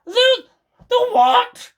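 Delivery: very high, loud startled hag voice; alarmed hag. Acted. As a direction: squeak it out in alarm. Mrs Twit startled.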